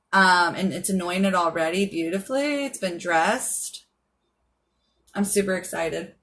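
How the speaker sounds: ordinary talk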